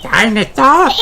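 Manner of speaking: put-on high toddler question; squeaky little brother asking; high piglet voice asking; George's voice. George asks in story.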